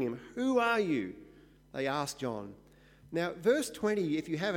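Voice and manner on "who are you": higher, pressing interrogation; grilling demand. Retold or imitated. Delegation grilling John, higher and pressing. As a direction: fire the question at him like an interrogator. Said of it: "they immediately begin grilling".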